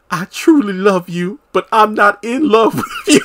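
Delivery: high-pitched hammed-up confession, breaking into a laugh; mock love confession. Karen letting a man down gently in the next episode, mock love confession. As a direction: play the soap-opera heartbreak high and silly.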